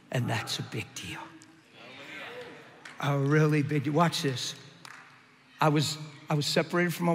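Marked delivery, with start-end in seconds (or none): 0.1-1.2 hushed, unvoiced whisper; breathy confiding whisper to the congregation; whispered aside. Real, not acted. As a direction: lean in and whisper it.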